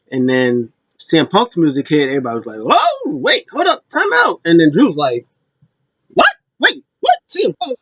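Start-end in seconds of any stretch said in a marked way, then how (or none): 2.6-4.4 very high-pitched mock shock; exaggerated, high-voiced disbelief; startled crowd reaction. 6.1-7.8 high-pitched, exaggerated bewilderment; squeaky stunned disbelief, played for laughs; mock shock. Acting out Drew's shock at Punk.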